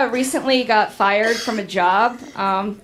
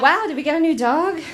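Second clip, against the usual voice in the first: higher, quick, laughing fake shock; mock surprise; 6 semitones higher, faster than usual. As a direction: act amazed at the dog's bare neck. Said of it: "and I was like".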